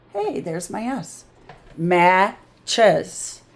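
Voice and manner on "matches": "Matches" as mat, then ches, with a gap; broken into syllables. Shown as a demonstration. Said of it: "look what happens when we have to break it".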